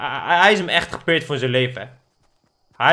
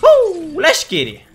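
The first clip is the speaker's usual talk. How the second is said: excited whoop; 12 semitones higher, 6 dB louder, much slower than usual. Real, not acted. Hyped as the round starts.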